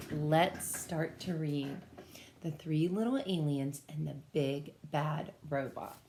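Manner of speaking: ordinary delivery, nothing marked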